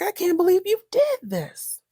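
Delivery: high, outraged Rich; shocked Rich. Retold or imitated. Rich to Pam, caught off guard, high disbelief. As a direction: squeak out his disbelief. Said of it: "Rich was like".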